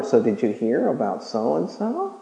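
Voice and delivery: low, hushed gossiping; gossip whisper. Retold or imitated. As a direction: lean in and dish gossip. Gossip at water cooler.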